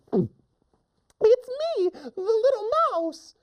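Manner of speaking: very high squeaky mouse voice; little mouse. Acted. Mouse answering the angry lion, tiny high squeak. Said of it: "the little mouse says".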